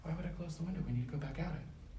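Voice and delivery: whispering. Real, not acted. Sneaking inside building.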